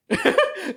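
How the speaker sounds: high, loud burst of laughter. Real, not acted. Reacting to the joke about grandma's mouth, loud high laugh.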